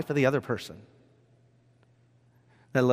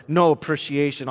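ordinary talk

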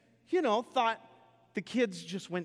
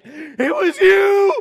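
high, very loud mock wail of blame; shrieked accusation; 18 dB louder, much slower than usual. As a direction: screech it like a wronged wife, drawn out.

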